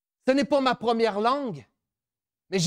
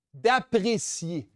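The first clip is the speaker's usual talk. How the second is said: D'apprécier drawn out slowly, every syllable weighted; slow emphasis; 4 semitones lower, much slower than usual. Shown as a demonstration. Speaker to students, stressing D'apprécier slowly. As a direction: lean slowly on D'apprécier to teach the word.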